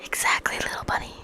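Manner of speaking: unvoiced menacing whisper. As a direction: whisper the taunt close. Villain whispers taunt.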